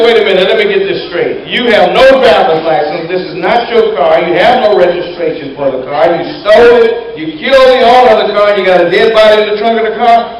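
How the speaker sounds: loud, high, piling up the charges; incredulous trooper. Acted. Trooper recaps the crimes, incredulous.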